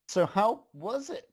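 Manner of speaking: lightly voiced eager pony; Pinky asking. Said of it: "Pinky asked".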